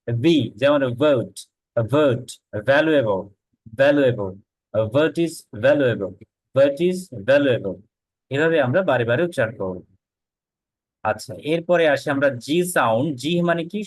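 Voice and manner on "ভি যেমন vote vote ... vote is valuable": vote and valuable with lip on teeth, slow; v sound drill. Shown as a demonstration. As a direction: model the v slowly. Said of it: "নিচে ঠোঁট এবং উপরে দাঁত". (নিচের ঠোঁট আর উপরের দাঁতে ভি)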